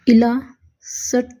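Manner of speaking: Elastic stretched out slowly; slow demo word. Shown as a demonstration. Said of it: "यहाँ पे भी बीच में आई है". (इलास्टिक धीरे से)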